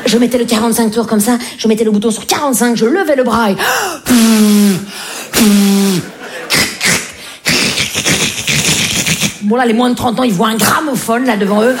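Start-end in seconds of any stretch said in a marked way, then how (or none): 3.6-9.4 long swooning gasp of delight; ecstatic gasp. Acted. Child hears the record start, with a long ecstatic gasp. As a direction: gasp as the music kicks in.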